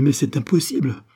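panicked, escalating shock; distraught. A narrator's reading. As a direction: build from disbelief to a loud, high cry. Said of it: "dit-elle éperdue".

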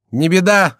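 high, loud call back; careless dismissal. A narrator's reading. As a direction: wave away his panic. Chicot calls back, teasing.

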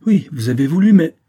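loud, high, strained outburst; choked anger. A narrator's reading. Vicomte accusing Saphir, cut short by choked anger. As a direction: throw it out and break off. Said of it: "La voix du vicomte était rauque, étranglée".

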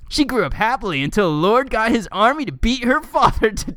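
swooping, lilting storybook read; mock-dramatic article reading. Reading fan fiction backstory to co-host, mock-dramatic. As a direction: read it like an epic backstory, tongue in cheek.

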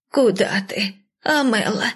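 plaintive call close to tears; tearful. A narrator's reading. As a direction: plead after her, voice full of tears. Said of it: "Голос няни был полон слёз".

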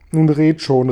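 loud, desperate plea. A narrator's reading. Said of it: "riefen sie verzweifelt".

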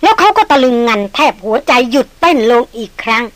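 usual speaking voice

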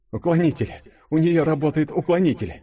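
high, excited exclamation; joyful realization. A narrator's reading. Денис realizing Юля is shielded from the bolts, joyful high exclamation. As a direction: exclaim with sudden relief. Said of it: "С радостью понял Денис".

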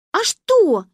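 loud, high, bullish retort; defiant. A narrator's reading. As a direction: throw it back stubbornly. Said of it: "Маня набычилась".

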